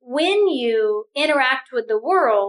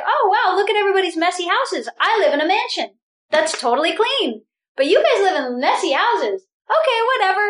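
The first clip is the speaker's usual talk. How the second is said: high, breezy, dismissive put-on voice; mock smug dismissal; 6 semitones higher, faster than usual. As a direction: sniff at the neighbors' messy houses, airily. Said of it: "So you're like".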